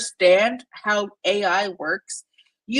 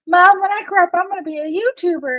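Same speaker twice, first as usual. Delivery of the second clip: put-on much higher, light child's voice; little daughter; 9 semitones higher than usual. Imitating her daughter's career dream, high child's voice. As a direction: chirp it like an excited little girl. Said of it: "my daughter who's like".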